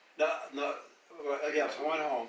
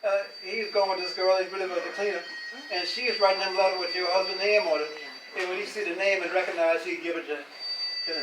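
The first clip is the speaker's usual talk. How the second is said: higher, louder, upset woman's complaint; upset neighbour; 5 semitones higher, 4 dB louder than usual. Retold or imitated. Neighbour complaining to Sarah, lightly voiced upset. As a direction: voice her hurt and outrage.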